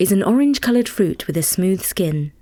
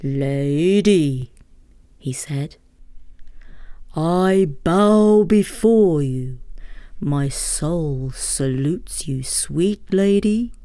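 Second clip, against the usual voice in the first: lower, slow wooing emperor; courtly emperor; 4 semitones lower, much slower than usual. Acted. Mikado courting the Lady Beaming Bright, lower and slow. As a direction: woo her slowly as the Mikado.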